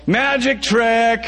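high, slow, stretched out yell; a gleeful high cry of triumph. A narrator's reading. Brit yelling at the Romeos, high and slow.

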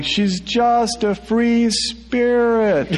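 high, slow yell. A narrator's reading. Narrator yelling as the bus pulls away. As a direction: call it out long and high. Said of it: "I'm yelling".

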